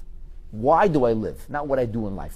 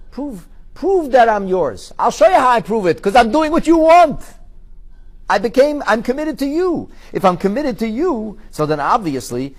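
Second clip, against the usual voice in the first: raised, slow, insistent pleading; loud insistent plea; 9 semitones higher, 9 dB louder than usual. Pretend. A believer proving devotion to God, loud insistent plea.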